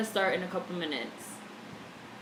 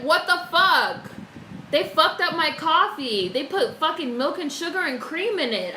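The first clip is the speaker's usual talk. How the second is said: loud, high, slowed mock rant; retelling the McDonald's coffee blowup in a loud indignant voice; retold outburst; 7 semitones higher, 5 dB louder than usual. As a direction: relive the coffee rage, outraged. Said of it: "I flipped out on live. I was like".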